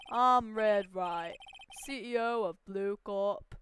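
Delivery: slow, loud self-introduction; pompous tycoon. Acted. CEO introducing himself to the young lawyer, loud and slow. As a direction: announce yourself like you own the room.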